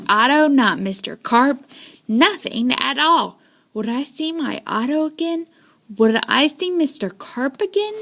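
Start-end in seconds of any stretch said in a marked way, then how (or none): none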